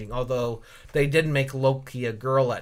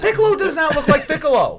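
high, loud, fast protest; mock outrage; 13 semitones higher, 7 dB louder, faster than usual. Real, not acted. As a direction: squawk it in laughing disbelief. Mock outrage over film's Piccolo.

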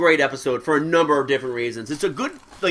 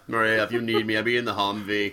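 Rainier Wolfcastle impression; 5 semitones lower, 6 dB quieter than usual.

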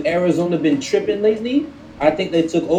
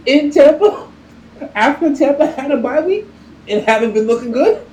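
incredulous; 10 semitones higher than usual. Real, not acted. Incredulous at picking Kirk Cousins.

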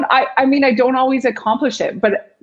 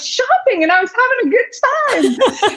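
high, laughing self-defence; playful pitched-up indignation; mock protest; 14 semitones higher than usual. Acting own protesting answer, laughing.

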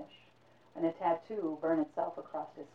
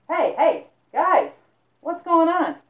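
calling out; 11 semitones higher, 12 dB louder, slower than usual. Acted. Calling to roommates.

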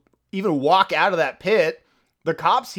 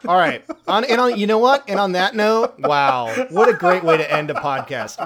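louder, higher wrap-up; loud outro; 6 semitones higher, 6 dB louder than usual. Real, not acted. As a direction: announce the ending, loud and high. Mock outro after crude joke.

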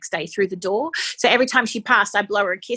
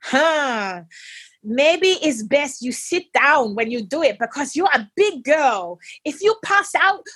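teasing nurse; 5 semitones higher, slower than usual. Retold or imitated. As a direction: tease the big patient. Nurse teasing, shout heard.

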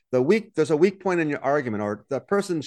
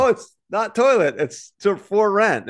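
realizing; 6 semitones higher, 4 dB louder, slower than usual. Retold or imitated. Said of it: "I'm like".

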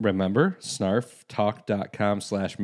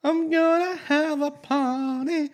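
party voice; slower than usual. Pretend. Mock party chant.

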